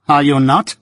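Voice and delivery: heated, indignant challenge; fierce retort. A narrator's reading. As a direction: hurl the question back fiercely. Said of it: "was the fierce retort".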